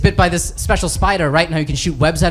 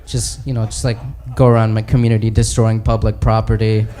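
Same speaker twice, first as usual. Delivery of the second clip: low, halting, embarrassed confession; Hulk; 7 semitones lower, 3 dB quieter than usual. Acted. Hulk admitting his powers to the other heroes, low and sheepish. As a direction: lower the voice and stumble through it awkwardly. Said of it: "he's talking to Thor and Captain America".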